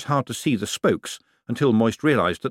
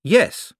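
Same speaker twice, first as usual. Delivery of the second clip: a higher, louder, emphatic "Yes"; 10 semitones higher, 5 dB louder than usual. Acted. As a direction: slam down the yes to outbid him. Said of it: "raising him an emphatically".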